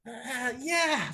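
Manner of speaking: high, tenor-like voice for the pastor. Retold or imitated. Imitating the caller's high tenor voice, high and sighing. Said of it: "matining yung boses niya, tenor yata eh".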